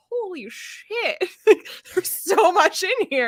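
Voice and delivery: high, stunned, with a laugh; amazed reread. Retold or imitated. Rereader stunned by the hidden clues, high amazed. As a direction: gasp it out in awe. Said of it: "you're like".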